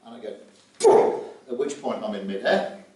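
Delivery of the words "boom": a sudden, much louder "Boom!". Retold or imitated. Telling listeners about the bailout, a loud mock boom.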